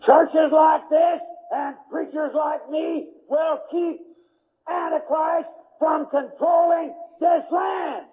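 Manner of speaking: full-force pulpit preaching; fiery preaching. Acted. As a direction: thunder it at the congregation.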